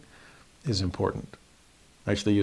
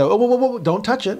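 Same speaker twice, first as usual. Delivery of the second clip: mock alarm; 10 semitones higher than usual. Teacher stopping a student reaching for candy, mock alarm. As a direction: stop them short with playful alarm.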